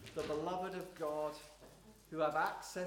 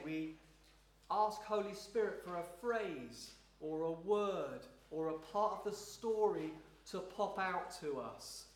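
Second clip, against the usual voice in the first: ordinary talk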